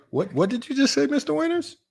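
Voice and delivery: incredulous. Real, not acted. Incredulous question to chatter.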